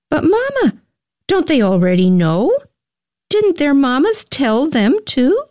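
put-on high, innocent little boy voice; a small son puzzling aloud; child voice. A narrator's reading. Narrator voices young Samuel.

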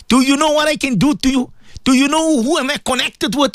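high-pitched indignant threat; upset boasting threat. Acted. Preacher imitating Muslims he has heard, high-pitched threatening. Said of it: "I heard Muslims speaking like this".

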